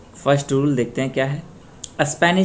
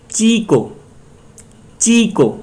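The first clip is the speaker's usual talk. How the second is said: Chikoo with ची high and loud, को dropping; stress on first syllable; 8 semitones higher, 4 dB louder, slower than usual. Shown as a demonstration. Teacher gives the rule's example, stressing ची in chikoo. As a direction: pitch up hard on the ची of chikoo. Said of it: "एक syllable पहले पे stress".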